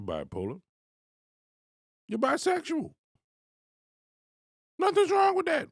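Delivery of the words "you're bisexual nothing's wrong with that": high, loud sudden shout. Real, not acted. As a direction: blast it out at him. Ranting at [name].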